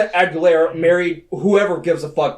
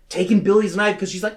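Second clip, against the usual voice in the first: usual speaking voice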